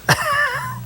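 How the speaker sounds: a quick high-pitched laugh. Real, not acted. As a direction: laugh brightly, amused. Laughs at the facilities.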